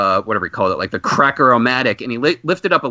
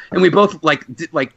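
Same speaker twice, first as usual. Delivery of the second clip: ordinary talk, nothing marked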